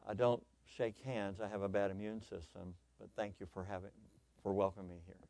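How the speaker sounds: soft, low, polite refusal; gentle kindness. Pretend. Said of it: "she could have had a kindness that would have said".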